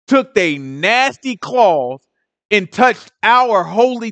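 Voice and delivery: slow, drawn-out, disgusted emphasis. Real, not acted. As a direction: linger on each nasty word.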